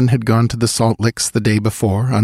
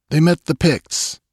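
brief answer; 9 semitones higher than usual. A narrator's reading. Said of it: "answered Balthus briefly".

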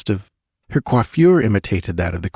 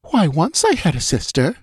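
uneasy queen; 9 semitones higher than usual. A narrator's reading. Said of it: "answered Taramis".

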